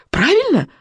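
high, urgent cry; called out. A narrator's reading. Boy demands confirmation from the witch, crying out. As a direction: call it into darkness with pitch leaping up. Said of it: "выкрикнул Икинг в темноту".

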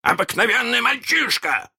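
high-pitched insistent question; guessing pirate. A narrator's reading. Pirate captain narrows it down, insistent.

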